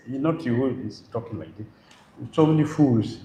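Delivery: usual speaking voice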